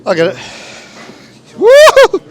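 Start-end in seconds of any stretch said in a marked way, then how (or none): 1.6-2.2 high, loud cheer; excited whoop. Real, not acted. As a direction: whoop it out in triumph. Cheer as task succeeds.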